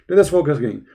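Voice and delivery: normal talking voice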